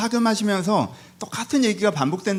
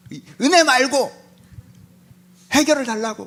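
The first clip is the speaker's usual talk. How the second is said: raised pitch, insistent protest; exasperated demand; 6 semitones higher than usual. Acted. Job wants a solution instead of grace, exasperated demand. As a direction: push the demand out in frustration.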